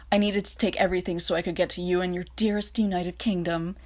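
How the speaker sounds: usual speaking voice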